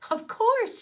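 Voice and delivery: high, loud excited cry; exclaimed. A narrator's reading. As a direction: burst out with delight. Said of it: "she exclaimed".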